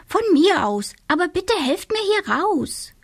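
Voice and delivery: high, put-upon witch voice; little witch voice. A narrator's reading. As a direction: sound like a scatterbrained little witch. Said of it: "witch begs".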